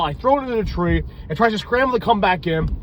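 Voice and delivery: usual speaking voice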